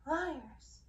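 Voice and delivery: a sudden furious cry, loud and sharp; angry outburst. Acted. The beast rejects what the sisters imply, furious cry. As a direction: spit the word out in disbelief.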